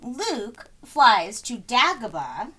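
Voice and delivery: ordinary delivery, nothing marked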